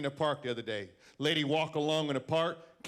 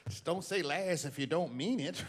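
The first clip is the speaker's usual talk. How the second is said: ordinary delivery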